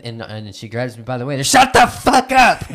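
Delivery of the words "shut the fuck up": yelling. Retold or imitated. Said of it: "he said".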